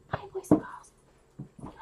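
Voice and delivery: slow, whispered hello; whispered greeting. Acted. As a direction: hush it like a secret. Hushed greeting.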